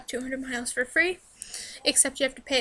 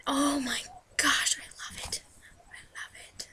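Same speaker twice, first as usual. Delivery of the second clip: swooning whisper; 8 dB quieter than usual. Real, not acted. Home cook gushing over the gravy, swooning whisper. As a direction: sink into a blissful hush.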